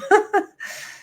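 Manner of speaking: high choked laugh through tears; real tearful laugh; teary laugh. Real, not acted. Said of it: "I'm gonna cry again".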